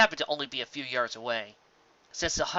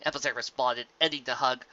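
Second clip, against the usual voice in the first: ordinary delivery, nothing marked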